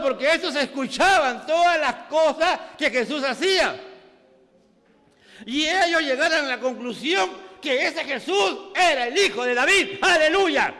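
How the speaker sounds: loud, high shouted sermon; fervent preaching. Acted. Pastor preaches to the congregation, shouting fervently. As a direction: preach it at full force.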